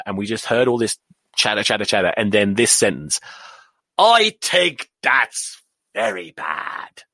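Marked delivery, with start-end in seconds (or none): 4.0-7.0 put-on drawn-out Irish old man's grumble; Irish accent. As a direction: quote a cross Irish grandfather, very slowly. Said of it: "he was yelling at my dad and my stepmother".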